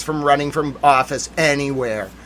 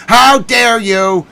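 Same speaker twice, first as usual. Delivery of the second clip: higher, louder, slow how dare you; drawn-out theatrical outrage; mock outrage; 5 semitones higher, 5 dB louder, much slower than usual. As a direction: stretch it out in hammed-up indignation. Mock outrage, louder second take.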